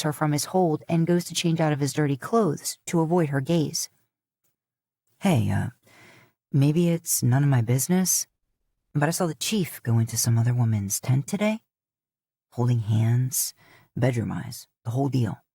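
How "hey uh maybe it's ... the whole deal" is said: lower, lightly voiced man; a hint of a sly husband sharing gossip; Dean's voice. A narrator's reading. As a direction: drop into a low gossiping male voice. Dean gossips to Mila.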